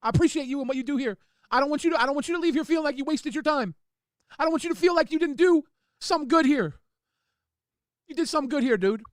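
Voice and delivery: high, loud, tearful pleading; crying. Real, not acted. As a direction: plead earnestly through real tears. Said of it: "I can't handle seeing [name] cry".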